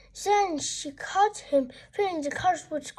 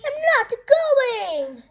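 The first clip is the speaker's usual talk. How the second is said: whiny refusal; 7 semitones higher, slower than usual. Acted. Henry refusing, howling.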